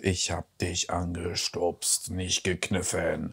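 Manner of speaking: hypnotist voice. Retold or imitated. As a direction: lull her into believing it, drawn out and hushed. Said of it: "dass er sie so hypnotisiert und sagt".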